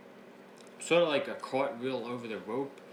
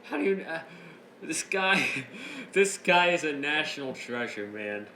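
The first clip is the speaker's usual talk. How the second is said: higher and louder, gushing through laughter; can barely contain the giggles; laughing excitedly; 6 semitones higher, 6 dB louder than usual. Real, not acted. Host gushing about Matt Riddle, laughing and excited.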